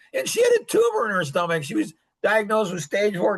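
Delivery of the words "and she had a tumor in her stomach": normal talking voice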